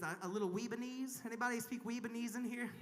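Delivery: ordinary delivery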